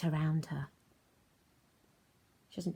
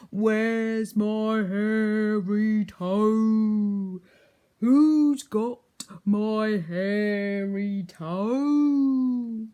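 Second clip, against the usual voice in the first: very slow, much louder haunting cry; ghostly wail; 4 semitones higher, 12 dB louder, much slower than usual. Acted. Ghost at the door, loud slow wail. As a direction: moan it from the door. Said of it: "it said".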